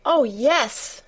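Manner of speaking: mild delighted exclamation; eager cry. Acted. Colin hears the newborn lamb, mildly excited cry. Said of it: "cried Colin".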